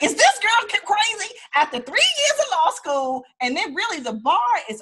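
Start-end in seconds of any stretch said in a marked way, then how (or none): 0.0-3.2 much higher, louder mock disbelief; mock husband in disbelief at another degree; incredulous husband. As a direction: play the baffled husband thinking she's lost it. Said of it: "he was like".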